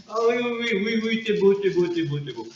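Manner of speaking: put-on high squealing baby talk to a pet; cooing baby talk. Greeting the new alien pet, high cooing baby talk. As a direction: coo at the fluffy creature, delighted.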